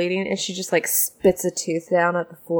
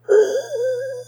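gasp; 3 dB louder than usual. Acted. Orc has wind knocked out.

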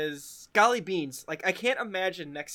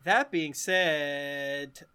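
slow; much slower than usual. Real, not acted. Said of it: "slow emphatic repeat".

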